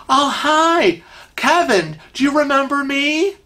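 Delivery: high-pitched, hopeful hello; eager greeter. Acted. Guy spots Kevin in the bank line, eager greeting. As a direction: greet him brightly up high, ending on a question. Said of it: "this guy comes over".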